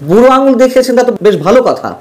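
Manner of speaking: sarcastic repeat; sarcastic emphasis. Acted. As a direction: throw the words back with scorn, আঙুল pitched high. Mocking repeat of Yunus's snub.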